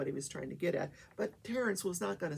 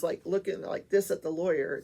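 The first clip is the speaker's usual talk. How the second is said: usual speaking voice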